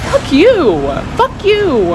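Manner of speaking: high, sharp mock outburst at the government; fed-up imitation of telling them off; defiant fuck you. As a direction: spit it out, rising in pitch on the second. Venting anger at the government.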